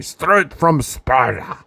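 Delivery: lightly voiced slow commentator catchphrase; quoting Stu. Quoting commentator Stu's catchphrase, slow mock announcer call. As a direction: drag it out like a hyped announcer, only a hint. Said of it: "as Stu would say".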